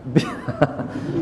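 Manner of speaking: breathy chuckle; soft laugh. Real, not acted. Preacher chuckling to his congregation, soft laugh. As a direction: laugh under your breath.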